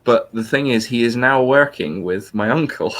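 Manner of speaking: ordinary delivery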